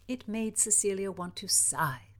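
plain reading voice

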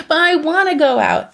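mock a teen begging to go out; whiny teenager. Mom voicing her thirteen-year-old begging, lightly whiny. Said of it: "a thirteen-year-old who's like".

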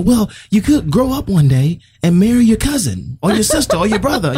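high, mildly yelled dad impression; imitated yelling dad. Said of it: "he yells out at us".

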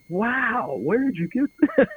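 high-pitched retold astonishment, breaking into laughter; wowed and laughing by the end; amazed reaction. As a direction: imitate a friend's delighted surprise, voice jumping up. Retold amazed reaction to the box set.

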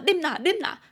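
urging a drink. Retold or imitated. Friends pressing drinks on Allen, urging.

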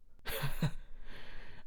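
breathy, high chuckle; short run of airy laughter; acted laugh. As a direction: laugh lightly before replying. Character laughs before replying.

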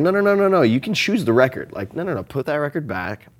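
high, insistent no no no; mock scolding. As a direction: scold yourself like a stern parent. Scolding own inner voice.

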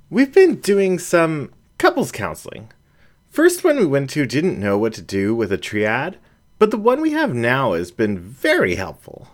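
a hint of an embarrassed raccoon admitting counseling; lightly voiced shy raccoon; raccoon Jen, sheepish. A narrator's reading. Jen continues her confession.

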